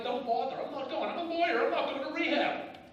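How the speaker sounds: high, loud, indignant refusal; retelling how he shouted down his brothers, defiant and loud; defiant protest. Retold or imitated. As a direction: push back hard, voice jumping up.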